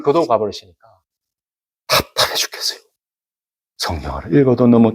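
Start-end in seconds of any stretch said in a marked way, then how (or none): 1.9-2.8 voiceless, stifled complaint; frustrated whisper. Retold or imitated. Preacher recalling a year without tears, frustrated whisper. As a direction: let it out as a soft, airy aside.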